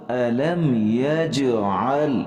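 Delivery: drawn-out tarteel chant, each word held long; slow Quran recitation. Shown as a demonstration. Teacher reciting the verse slowly for students.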